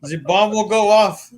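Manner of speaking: a cartoon terrorist threat, overplayed; mock Middle Eastern accent. Shown as a demonstration. Keeps the Tusken Raider voice going to his co-host. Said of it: "I've got written down Tusken Raider".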